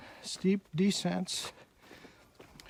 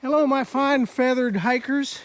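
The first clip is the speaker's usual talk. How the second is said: cheery vlog greeting; 5 semitones higher, 7 dB louder than usual. Acted. Vlog host greeting, loud.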